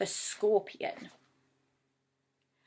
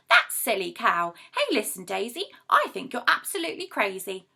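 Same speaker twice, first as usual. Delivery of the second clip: a louder storybook yell at the cow; 7 dB louder than usual. Acted. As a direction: bellow it from the crowd. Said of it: "raised up his voice and yelled aloud".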